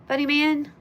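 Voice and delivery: high, loud, teasing name call; accidentally smug. Real, not acted. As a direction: sound unintentionally smug saying the name. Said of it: "Now I sound like I'm being smug".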